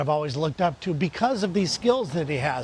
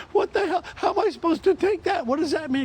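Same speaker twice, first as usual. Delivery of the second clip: incredulous; 12 semitones higher, 3 dB louder than usual. Retold or imitated. Reacting to the friend's insult.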